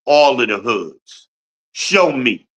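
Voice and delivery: ordinary delivery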